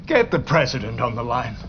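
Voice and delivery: normal talking voice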